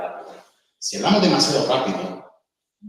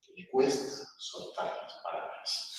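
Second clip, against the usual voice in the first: slow, halting, softer; slow halting speech; 9 dB quieter, slower than usual. Shown as a demonstration. Teacher showing students slow halting speech. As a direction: drag the words out reluctantly. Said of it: "cuando alguien habla así".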